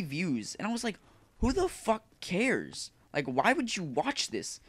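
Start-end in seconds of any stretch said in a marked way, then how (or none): 1.4-4.6 slow, drawn-out disbelief, pitch well up; incredulous scorn. Retold or imitated. Quoting his own thought to viewers, slow incredulous scorn. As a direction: stretch it out like you can't believe it.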